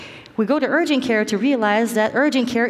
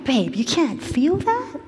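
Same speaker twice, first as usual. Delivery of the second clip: higher-pitched disbelieving question; incredulous; 6 semitones higher than usual. Retold or imitated. Wife questioning her husband's numb feet, incredulous. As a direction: ask in disbelief, rising at the end.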